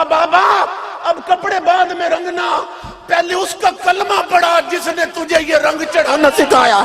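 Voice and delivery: high, loud pleading cry. A narrator's reading. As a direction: shout it out as she breaks down. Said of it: "اس کی چیخ نکل گئی". (بلند آواز میں چیخ کر التجا)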